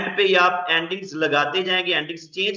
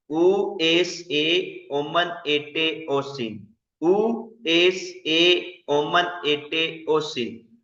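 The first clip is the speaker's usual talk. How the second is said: very slow recitation; much slower than usual. Shown as a demonstration. Teacher repeating the paradigm for students to memorise, very slow. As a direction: stretch out every ending, very slow and even. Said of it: "इसको याद करना है".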